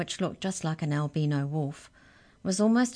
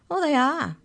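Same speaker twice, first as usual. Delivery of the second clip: mouse voice; 10 semitones higher, 5 dB louder than usual. Acted.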